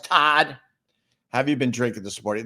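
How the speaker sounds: usual speaking voice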